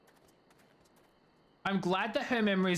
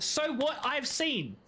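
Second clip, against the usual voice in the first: drawn-out high quoting; slow mock quote; 11 semitones higher, much slower than usual. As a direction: stretch each word slowly. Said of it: "Maybe it was the first few words of that".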